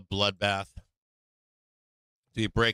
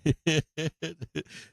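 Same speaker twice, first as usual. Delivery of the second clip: laughing; 7 semitones higher than usual. Real, not acted. Laughs at own joke.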